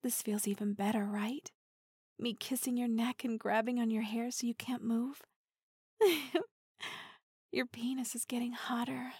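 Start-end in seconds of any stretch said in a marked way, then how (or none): 2.2-5.2 intimate teasing whisper; close, breathy-free hushed tease. Acted. 7.5-9.1 intimate teasing whisper; close, breathy-free hushed tease. Acted.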